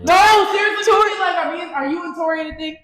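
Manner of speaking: high, loud excited outburst; excited shout. Real, not acted. Friend bursting in to grill about Tori, excited shout.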